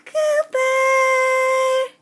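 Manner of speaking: loud call across the house. Real, not acted. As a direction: shout his name to fetch him. Calling the other child over.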